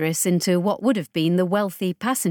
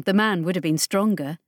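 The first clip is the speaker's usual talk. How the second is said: plain reading voice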